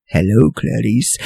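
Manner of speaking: low, soft, creepy Hello, Clarice; softly sinister doctor; creepy Hannibal. Retold or imitated. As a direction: let the ick ooze off her name. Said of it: "he says it so creepily".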